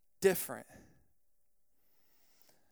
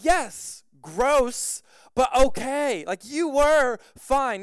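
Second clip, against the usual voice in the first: raised, louder imitation of a friend insisting; concede, then stress the point slowly; mock-exasperated reassurance, drawn out at the end; exasperated insistence; 4 semitones higher, 6 dB louder, much slower than usual.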